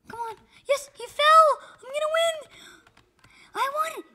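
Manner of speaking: high loud victory yell; excited shout. Real, not acted. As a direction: scream with joy as you win. Winning the game.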